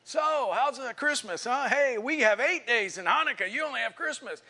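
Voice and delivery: put-on high, teasing kid's taunt; mocking. [name] ribbing his friends about Christmas, mocking. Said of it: "make fun at Mike or me".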